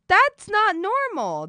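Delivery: very high, slow, loud squeal; protesting to chat in a high squeal; squeaky outrage. Real, not acted. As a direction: squeal it out in disbelief.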